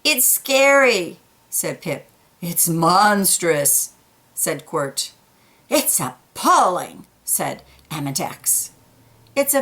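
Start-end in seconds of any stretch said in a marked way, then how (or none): none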